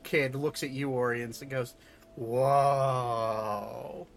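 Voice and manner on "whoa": a lightly voiced orc boy, awed; awed orc kid. DM as orc boy gaping at big Ori, mild awed whoa. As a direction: gape up at someone huge. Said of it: "Like that".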